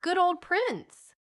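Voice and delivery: high, slow happy call; delighted cry. A narrator's reading. Polly hears the dog got a cake, crying out in delight. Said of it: "cried Polly".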